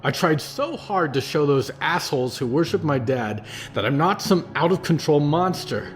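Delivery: put-on a furious young man venting his pain; rising anger. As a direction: let the rage boil over, then grind it out. Percy rages at the cult.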